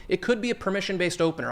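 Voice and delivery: ordinary talk, nothing marked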